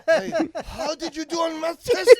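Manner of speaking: loud, high mock Chinese parent. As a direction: scold a kid in a hammed-up Chinese accent. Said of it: "in his accent".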